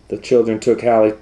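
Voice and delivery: usual speaking voice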